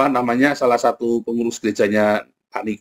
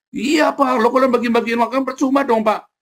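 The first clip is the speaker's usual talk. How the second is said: naive church officer; 10 semitones higher than usual. Retold or imitated. Church officer voiced, high pitch.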